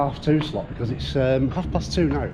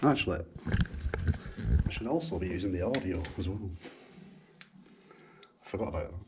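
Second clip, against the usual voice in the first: hushed; 7 semitones lower, 14 dB quieter than usual. Real, not acted. Filming secretly where it's banned.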